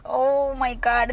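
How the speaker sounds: loud, slow, drawn-out shock; dawning realization. Retold or imitated. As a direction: stretch it out as it sinks in. The realization hits her.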